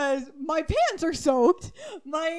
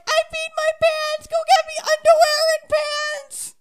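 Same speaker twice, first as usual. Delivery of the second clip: much higher, louder, teary-laughing demand; desperate laughing plea; 10 dB louder than usual. Retold or imitated. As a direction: beg dad urgently through laughter. Begging dad for clothes.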